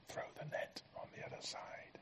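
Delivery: hushed whisper, almost no voice. Acted. Preacher to congregation, voicing Jesus's call in a whisper. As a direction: breathe it like a secret call from the shore.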